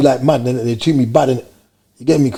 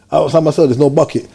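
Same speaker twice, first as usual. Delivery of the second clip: ordinary talk, nothing marked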